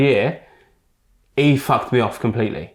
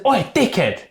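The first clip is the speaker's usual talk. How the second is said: high-pitched mock yell; retold angry call-out; 8 semitones higher, slower than usual. Young player calling after man in tunnel, angry. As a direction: call him out, re-enacted.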